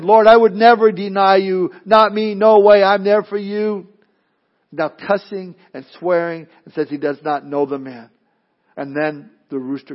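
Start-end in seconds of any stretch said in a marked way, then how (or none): 0.0-3.9 loud, emphatic pledge of loyalty; bold vow. Acted. Peter vowing to the Lord never to deny him, loud and bold. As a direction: swear it loudly, leaving no room for doubt.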